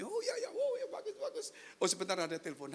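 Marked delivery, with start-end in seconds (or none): 0.0-1.5 high, laughing careless pastor voice; mock a lazy pastor brushing off prayer; mocking casual pastor. (meniru pendeta yang asal-asalan sambil tertawa)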